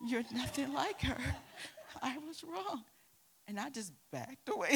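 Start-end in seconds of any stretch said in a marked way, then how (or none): 0.1-2.8 quoting through laughter; laughing. Retold or imitated. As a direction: laugh while you repeat it. Teacher repeats apology, teller laughing.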